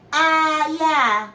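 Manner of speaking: imitating someone hardly convincing; unconvincing yeah. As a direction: stretch out a doubtful "ah, yeah". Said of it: "That don't sound like you really getting along".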